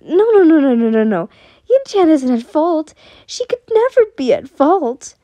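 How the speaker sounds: loud, high, desperate protest; frantic insistence, pitch leaping up; frantic denial. A narrator's reading. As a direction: refuse to believe it, panicking. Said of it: "shaking his head with great denial".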